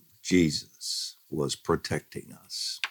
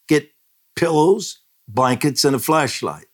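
retold shout; 9 semitones higher than usual. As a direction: call it out sharply over the storm. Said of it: "my husband shouted".

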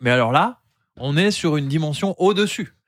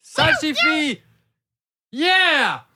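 fist up and cheer it out; 12 semitones higher, much slower than usual. Pretend. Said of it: "je lève le poing en l'air et je crie". (cri de joie aigu)

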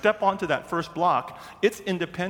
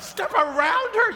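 very high-pitched comic shriek of disbelief; hammed-up, shrill incredulous echo. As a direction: squeal the question in mock panic.

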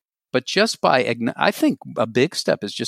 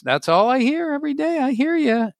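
silly mock voice; 13 semitones higher than usual. Continuing silly voice.

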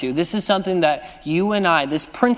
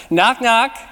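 bright, raised call to the room; playful joke opener, raised; called-out knock knock; 5 semitones higher, 4 dB louder than usual. Pretend. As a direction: call it out so everyone answers. Leading congregation in knock-knock joke.

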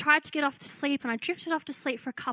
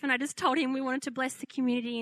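ordinary delivery

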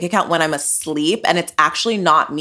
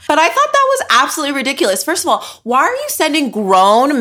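exasperated rant; 8 semitones higher, faster than usual. Real, not acted. Ranting about the bill visit.

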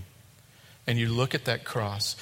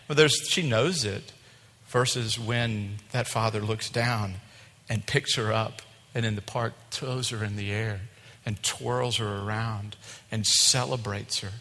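ordinary talk, nothing marked

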